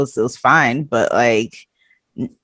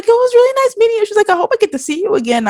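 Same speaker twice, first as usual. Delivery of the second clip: much higher and fast, sweet teen imitation; high quick mock daughter; 14 semitones higher, much faster than usual. Daughter saying goodbye to the guest, high and fast. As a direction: gush like a polite teen.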